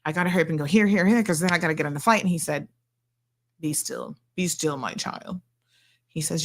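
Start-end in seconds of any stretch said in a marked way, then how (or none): 0.1-2.2 rushed, rapid-fire delivery; real hurry. Retold or imitated. Patient fretting to acupuncturist about catching a flight, hurried. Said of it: "in a real hurry". 3.6-5.4 soft, low, soothing reassurance; calming. Retold or imitated. Acupuncturist quieting a patient rushing for a flight, calming. Healer calms the hurried patient.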